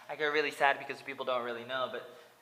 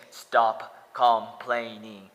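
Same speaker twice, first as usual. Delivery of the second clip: loud, slow drawn-out mock scolding; mock yell; 4 semitones lower, 12 dB louder, much slower than usual. Critics online yelling at him, loud slow mock scolding. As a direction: bellow it slowly like a scolding critic. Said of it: "They say".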